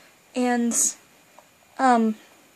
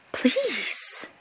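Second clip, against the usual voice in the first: normal talking voice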